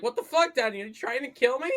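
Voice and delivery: high, loud startled protest; mock outrage. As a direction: exclaim as if nearly killed, hammed up. Acts out Jack's outraged alarm.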